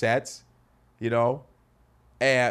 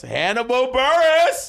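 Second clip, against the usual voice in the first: excited; 11 semitones higher, 8 dB louder, slower than usual. Real, not acted. Excited name drop.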